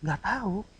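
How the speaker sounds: soft, gentle admission of not knowing; mild reply. Shown as a demonstration. Preacher showing how intonation changes words, mild reply. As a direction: say it quietly, without any edge. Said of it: "Kan beda ya".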